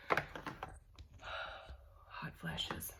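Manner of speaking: whispered after a sigh, worn out. Real, not acted. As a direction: breathe it out, exhausted. Sighing about hot flashes again.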